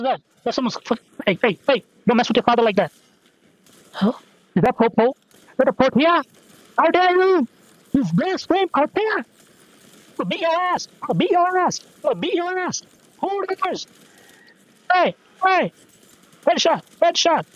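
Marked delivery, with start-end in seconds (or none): 3.9-13.9 high, drawn-out outraged character voice; mock outrage. Gamer defending Portia from blasphemy, high mock outrage. 14.9-17.4 high-pitched exclamations in a put-on voice; high mock battle cries. Gamer announcing headshots in play, high silly voice.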